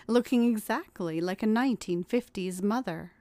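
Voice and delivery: ordinary delivery, nothing marked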